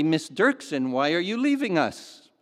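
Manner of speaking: a loud, pointed demand from the boss; sharp question. Acted. Store manager to a resigning employee, sharply demanding. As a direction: snap the question at her like a displeased manager. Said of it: "asked sharply".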